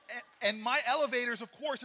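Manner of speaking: ordinary talk, nothing marked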